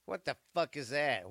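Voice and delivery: ordinary delivery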